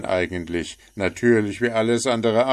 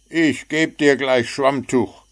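louder, higher angry gnome; wütend fauchend; furious snap; 7 semitones higher, 7 dB louder than usual. A narrator's reading. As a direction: snarl the threat. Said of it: "fauchte Puh".

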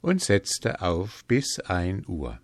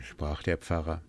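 ordinary delivery

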